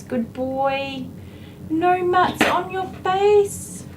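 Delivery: high, slow pet-talk coo; cooing praise. Real, not acted. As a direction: gush sweetly over the dog. Baby-talk praise to the dog.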